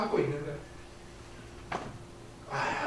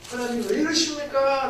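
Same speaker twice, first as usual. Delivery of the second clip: louder, higher protest to God; retold pained outcry; 7 semitones higher, 5 dB louder than usual. Pastor in malaria pain crying out to God, louder and higher. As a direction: cry out to God from sickness, raised voice. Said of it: "제가 생으로 그렇게 아파 있는데".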